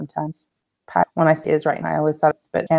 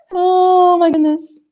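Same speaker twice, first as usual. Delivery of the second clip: high, loud and slow; laughing exclamation; 13 semitones higher, 6 dB louder, slower than usual. Real, not acted. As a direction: stretch it out, laughing. Laughing at the glitch.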